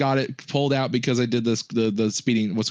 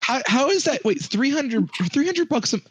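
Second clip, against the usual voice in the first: can't believe the number, voice leaps higher; incredulous; 11 semitones higher than usual. Real, not acted. Friend reacts to a quote of three hundred bucks, shocked and much higher. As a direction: pitch jumps way up in shocked disbelief. Disbelief at the insurance price.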